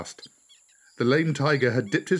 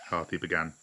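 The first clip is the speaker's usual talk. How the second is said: usual speaking voice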